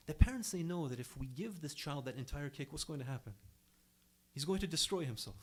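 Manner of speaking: ordinary delivery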